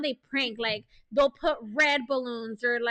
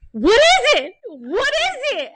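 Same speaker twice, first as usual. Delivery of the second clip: baffled, urgent asking, pitched way up; clueless husband; 9 semitones higher, 10 dB louder, slower than usual. Retold or imitated. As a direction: imitate a husband demanding an answer, high and loud. Clueless husband demands the answer.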